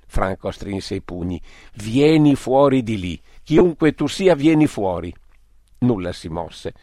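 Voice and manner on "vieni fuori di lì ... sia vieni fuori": louder, higher furious demand; angry challenge. Acted. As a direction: call him out, fists clenched. Franco stringe i pugni, minaccia. (Franco al presunto indigeno, ordine urlato e furioso)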